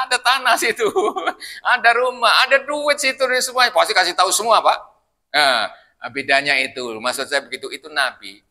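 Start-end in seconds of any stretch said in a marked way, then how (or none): 0.1-3.7 high, laughing delivery. Real, not acted. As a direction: giggle through the words. Laughing at own joke.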